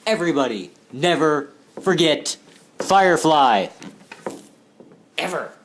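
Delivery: Firefly announced loudly to everybody; loud proclamation. Shown as a demonstration. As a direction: proclaim it to the whole world. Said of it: "so insanely important".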